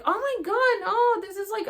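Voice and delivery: high, loud mock whining; complaining. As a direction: whine it, overdoing the misery. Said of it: "I was complaining, complaining, complaining".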